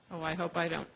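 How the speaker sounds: ordinary talk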